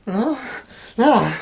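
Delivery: high, loud cry from a nightmare; panicked waking no; frightened cry. Acted. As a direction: cry out in terror. Sick monk wakes from nightmare.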